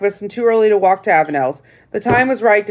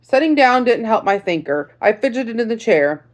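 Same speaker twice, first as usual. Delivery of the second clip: usual speaking voice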